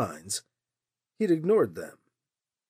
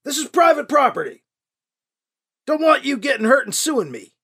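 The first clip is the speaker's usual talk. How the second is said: higher, louder yelled order; yelling; 9 semitones higher, 5 dB louder than usual. A narrator's reading. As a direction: yell the command up the hill. Man keeps yelling.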